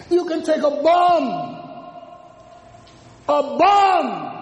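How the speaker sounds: preaching at full force. Acted.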